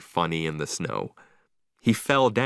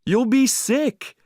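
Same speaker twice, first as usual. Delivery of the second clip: high, loud, slow warning; alarmed shout; 5 dB louder, slower than usual. A narrator's reading. Owner warning Marley in the water, high loud shout. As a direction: drag it out as a worried warning.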